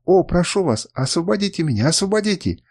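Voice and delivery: high, urgent begging; hopeful, high-pitched pleading; exclaimed plea. A narrator's reading. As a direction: beg to be freed, pitch climbing. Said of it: "воскликнула она".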